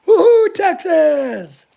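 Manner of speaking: slow, high, loud hammed-up whoop; mock Texas cheer. Mocking Texans' team spirit to a friend, hammed-up whoop. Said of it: "not into that whole".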